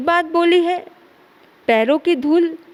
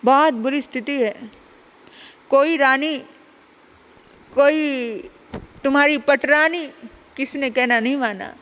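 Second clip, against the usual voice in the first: dejected report; 3 semitones lower, 3 dB quieter than usual. Acted. Uddhav returns dejected.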